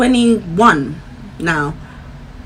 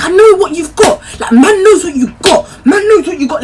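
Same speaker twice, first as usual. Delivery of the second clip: mimicking boyfriend; 7 semitones higher, 4 dB louder than usual. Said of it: "he's like".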